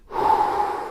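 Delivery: full breath done aloud; audible deep breath. Shown as a demonstration. Breathing along with the viewer, full and audible. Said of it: "try that with me right now".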